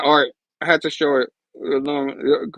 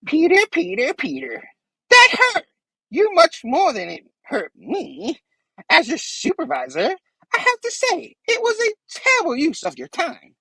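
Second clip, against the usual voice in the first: condescending villain lecture, pitched up; Green Goblin voice; 13 semitones higher than usual. Retold or imitated. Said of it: "Green Goblin's like".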